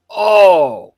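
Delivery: loud, high drawn-out "oh"; big exclaimed oh of dawning realization; sudden realization. Retold or imitated. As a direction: re-enact the penny dropping. Acting out moment of realization.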